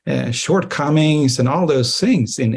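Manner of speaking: ordinary delivery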